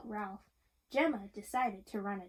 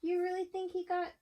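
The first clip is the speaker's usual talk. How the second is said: higher, teasing storyteller question; playful questioning; 7 semitones higher than usual. Acted. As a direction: tease the child with a higher voice. Reader voices the story's question.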